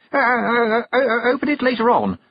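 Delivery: high, loud, flustered stammer; blushing stutter. A narrator's reading. Young noble flustered by a curtsy, stuttering. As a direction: trip over the first sounds in embarrassment. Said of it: "made Roland blush and stutter".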